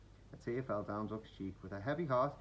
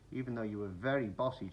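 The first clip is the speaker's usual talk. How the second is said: plain reading voice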